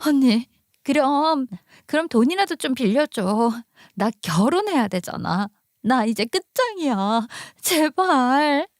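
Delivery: plaintive sister-in-law pleading; beg, drawing out the last plea; begging tone. Acted. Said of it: "두 손 모으고 거의 비는 톤으로". (거의 비는 듯 애원하며)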